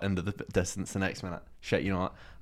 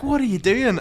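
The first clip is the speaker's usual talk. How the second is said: higher, louder challenge; mock angry lad; 13 semitones higher, 6 dB louder than usual. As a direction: bristle at him. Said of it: "he was like".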